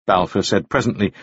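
ordinary delivery, nothing marked

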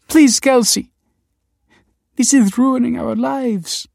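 high, loud, drawn-out plea; desperate begging. A narrator's reading. Nina grabbing Kelsey's arm, desperate. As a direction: wail it as a last appeal.

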